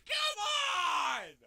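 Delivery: high, loud, stretched shout; real furious outburst; exasperated yell. Real, not acted. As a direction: holler it at the game. Screaming at failure.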